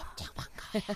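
playful whispered greeting. Pretend. Co-hosts greeting each other in secret, whispering. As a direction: whisper how pleased you are. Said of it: "목소리가 안 나와요".

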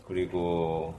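usual speaking voice

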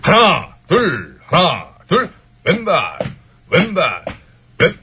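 high barked drill count; barking gym coach. Acted. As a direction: call the left foot like a drill sergeant. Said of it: "이 대표가 구령을 붙여봐요".

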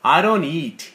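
"I don't eat" linked, T in don't barely sounded; weakened T. Shown as a demonstration. Teacher models for student, "don't eat" linked with weak T. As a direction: soften the T and glide "don't" into "eat". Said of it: "T 사운드를 약하게 발음하는 거죠".

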